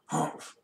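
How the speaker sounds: breathy humph; grumpy grunt. Acted. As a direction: huff it out crossly. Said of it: "grunted Mister Grumpy".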